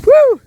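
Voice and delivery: high loud woo; excited whoop. Real, not acted. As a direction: let out a joyful woo. Bouncing on trampoline.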